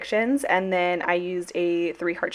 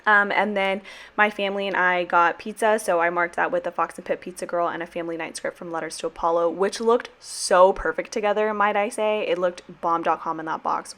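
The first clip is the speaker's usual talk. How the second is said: normal talking voice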